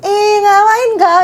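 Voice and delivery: high, lilting Karo speech, gentle; playful imitation of Karo auntie talk; high soft Karo lilt. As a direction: sing it up high but sweet. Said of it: "kayak tinggi tapi yang kayak lemah lembut".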